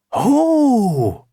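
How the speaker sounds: high, loud gasp of wonder; a big storybook "oh!"; surprised. A narrator's reading. Kipper seeing the magic key glow, surprised.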